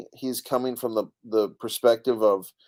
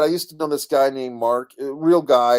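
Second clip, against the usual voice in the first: normal talking voice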